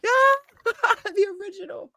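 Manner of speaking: very high, laughing squeal; excited squeal. Real, not acted. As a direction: squeal with giddy delight. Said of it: "I'm excited".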